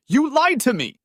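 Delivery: sharp betrayed shout, high and loud. A narrator's reading. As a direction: yell it out in hurt. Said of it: "It was all I could do not to shout".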